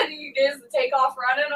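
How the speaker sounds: ordinary talk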